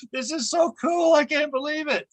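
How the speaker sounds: giddy disbelief at floating free; giddy delight. Retold or imitated. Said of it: "I'm up on the ceiling giddy".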